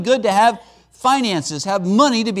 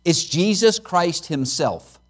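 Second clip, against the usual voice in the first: ordinary talk, nothing marked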